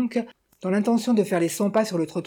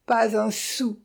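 moaning; 4 semitones higher, much slower than usual. Acted. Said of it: "gémit la veuve avec accablement".

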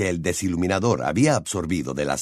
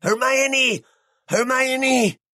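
very high, frantic screech of a name; acted desperate scream; bellowing; much slower than usual. A narrator's reading. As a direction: shriek the name again and again in panic. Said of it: "Ron reanudó sus berridos".

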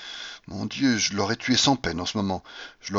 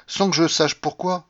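plain reading voice

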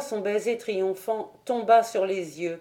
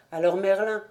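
plain reading voice

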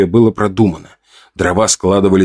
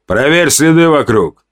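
firm, higher-pitched command; 6 semitones higher than usual. A narrator's reading. Chief sending the hunter to check tracks, firmly. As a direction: give a steady, firm order. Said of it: "твёрдо сказал вождь".

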